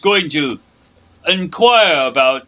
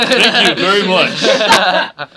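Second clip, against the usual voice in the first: mock gracious thanks; 3 semitones higher, 4 dB louder, much slower than usual. Player mock-gracious over good roll.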